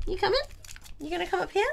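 high, inviting pet talk; coaxing a pet. Real, not acted. Streamer coaxes the cat onto the desk, high pet voice. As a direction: coax a cat with rising questions.

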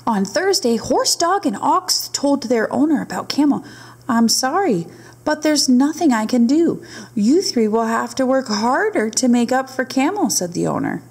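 lively storybook narration; reading to children. A narrator's reading. Teacher reading a camel story to her class, lively storybook read. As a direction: read it aloud to the little ones. Reads picture book aloud.

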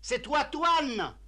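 slow, high, spelled-out repeat; speaking up for deaf aunt. Acted. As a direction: stretch every word for someone hard of hearing. T'entends toujours pas mieux.

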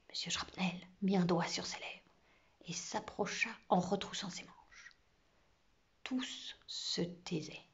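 suspenseful whispered narration, slowing at the end; hushed whisper. A narrator's reading. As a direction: whisper it so as not to scare the mouse. Narrator hushes as class falls silent. (chuchotement plein de suspense)

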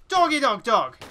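high, loud cry of alarm; real jump-scare outburst; startled panic. Real, not acted. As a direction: yell in panic at an attacking dog. Startled by a dog in the game.

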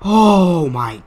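loud, high, drawn-out disbelief; slow, loud exclamation; shocked. Real, not acted. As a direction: stretch it out in shock. Reacting to scare in game.

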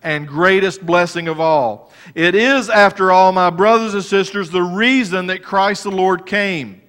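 full-force sermon delivery; fervent preaching. Acted. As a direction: preach it out to the congregation.

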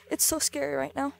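ordinary talk, nothing marked

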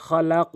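খলাক with the final ক pushed upward and loud; loud খলাক ending in a bounced ক; qalqala on stop. Shown as a demonstration. Teacher showing qalqala at a pause, loud. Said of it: "এটা উপরের দিকে".

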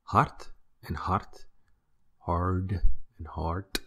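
"Hart and hart, hard and heart" slowly, both with the same t ending; slow model of identical Dutch words; same Dutch pronunciation. Shown as a demonstration. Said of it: "are pronounced the same".

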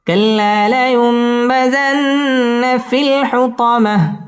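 slow, sung tajweed chant; Quran recitation. Shown as a demonstration. Teacher reciting the surah for students to follow, chanted. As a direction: let each line ring out melodically.

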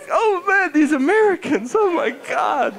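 high, scandalised exclamation; imitated appalled family. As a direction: mimic horrified onlookers in a high voice. French picnickers appalled by Americans.

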